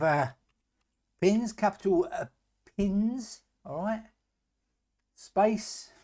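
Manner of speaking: slow word-by-word dictation; dictating. Shown as a demonstration. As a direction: spell out code piece by piece. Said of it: "dictating code word by word".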